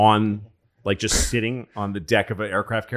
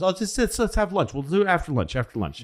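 higher, airy dismissive voice; breezy Roosevelt brush-off; 9 semitones higher, faster than usual. Retold or imitated. Roosevelt putting Churchill off until after lunch, higher airy brush-off. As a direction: wave him off lightly, pitched up. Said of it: "F D R goes".

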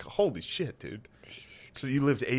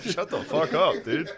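laughing retort; 7 semitones higher than usual. Retold or imitated.

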